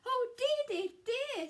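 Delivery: high, loud, fretful cry; frightened wail. Acted. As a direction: wail in panic. Scared Square at door.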